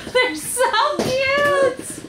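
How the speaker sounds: gushing coo with a laugh; drawn-out high squeal of delight; cooing, high and slow. Real, not acted. Cooing over tiny clothes.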